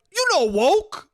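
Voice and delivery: high, loud, pushy uncle voice; mock insistent shout. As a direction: insist they must know it. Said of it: "he's not looking at us to be like".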